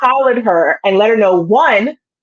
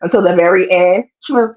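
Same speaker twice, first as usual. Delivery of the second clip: ordinary talk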